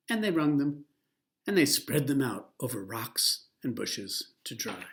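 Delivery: plain reading voice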